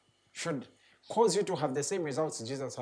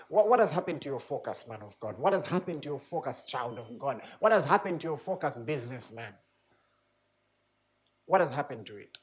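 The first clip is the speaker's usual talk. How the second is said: preaching challenge; 4 semitones lower than usual. Real, not acted. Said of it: "preacher challenges congregation".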